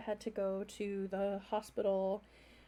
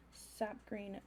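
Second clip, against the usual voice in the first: ordinary talk, nothing marked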